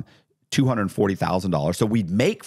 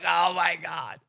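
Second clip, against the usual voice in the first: real laugh breaking into the words, higher; laughing disbelief; 11 semitones higher, 3 dB quieter, slower than usual. Real, not acted. Retelling the FBI call to the host, laughing. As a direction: laugh at the absurd timing.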